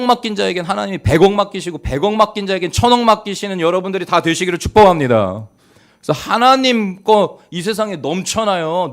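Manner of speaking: impassioned blessing over the congregation; fervent preaching. Real, not acted. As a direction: exhort the congregation with mounting force. Preaches at rising force.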